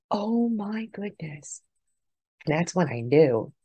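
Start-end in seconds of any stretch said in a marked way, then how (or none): 0.1-1.6 slow, soft, higher awed murmur; awestruck. Retold or imitated. Watching her dad embraced in light, slow soft awe. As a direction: breathe it out slowly in wonder. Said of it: "And it was just like".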